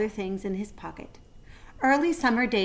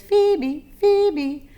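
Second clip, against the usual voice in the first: high, loud birdsong; phoebe's call; 10 semitones higher, 9 dB louder than usual. A narrator's reading. Narrator voicing the teetering phoebe bird, high and loud. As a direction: chirp it like a phoebe.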